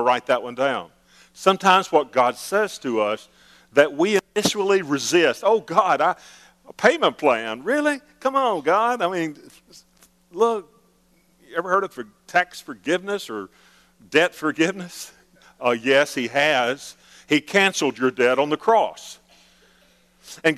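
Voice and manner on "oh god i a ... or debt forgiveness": high, incredulous whine at God; mock indignant prayer. Preacher mimicking a believer scoffing at God. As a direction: scoff at God's payment plan in disbelief.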